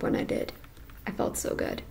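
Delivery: breathy whisper. Real, not acted. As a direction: whisper it, savoring. Savoring a good night's sleep.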